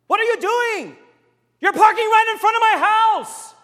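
screamed high and loud at a stranger; furious shout. Retold or imitated. Angry homeowner screaming at Frank for parking there, furious shout. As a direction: yell it, outraged and shrill-high.

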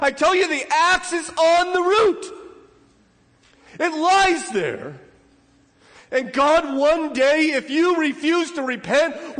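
loud, high-pitched pulpit warning, slowing on the threats; fiery preaching. Acted. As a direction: roar the warning at the congregation. Preacher at full force.

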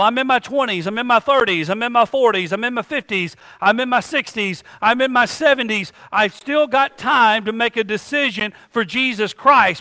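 ordinary delivery

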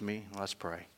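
hushed pastoral prayer; closing prayer. Acted.

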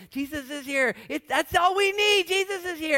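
very high, loud giddy joy; ecstatic Mary. Retold or imitated. Mary squealing with joy that Jesus came. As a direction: squeal like the best news ever.

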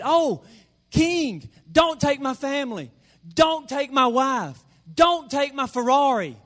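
high, loud hammed-up pleading; desperate cry. Servant begging the king for his family, mock desperate cry. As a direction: beg loudly, playing up the desperation. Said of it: "it was a desperate cry".

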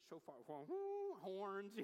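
playful drawn-out horn noise; imitated horn blast. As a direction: hoot like a ram's horn mid-phrase.

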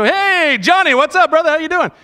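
high-pitched, hearty phone hello; excited greeting. Acted. Pastor acting out recognising a friend on the phone, excited greeting. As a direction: greet an old buddy with a big rising lift.

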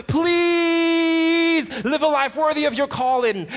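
pleading. Retold or imitated. Paul begging the church to live their calling, slow pleading. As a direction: beg them slowly, voice straining upward. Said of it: "He's begging them".

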